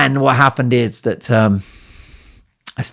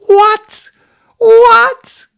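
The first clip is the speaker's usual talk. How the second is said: shrieked, far higher and louder falsetto what; high squeaky falsetto what; mock disbelief; 9 dB louder than usual. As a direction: squeal in fake astonishment.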